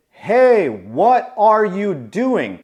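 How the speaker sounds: high, loud call, then drawn out; mock alarmed yell, stretched slow; retold yell. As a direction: imitate yelling at a friend from across the street. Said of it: "When I yell something".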